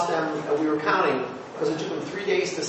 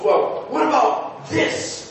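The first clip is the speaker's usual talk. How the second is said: higher, slow, whispered challenge; pointed challenge; 11 semitones higher, 4 dB louder, much slower than usual. Retold or imitated. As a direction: draw it out like a gotcha. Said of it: "And Tom goes".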